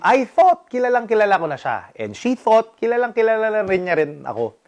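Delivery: ordinary delivery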